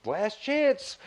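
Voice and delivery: high-pitched retold warning; mock ultimatum. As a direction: play out the contractor's final threat. Retold contractor's final threat.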